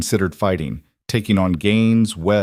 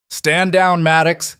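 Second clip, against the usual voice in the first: shouted command; 9 semitones higher, 4 dB louder, slower than usual. A narrator's reading. Captain shouting order.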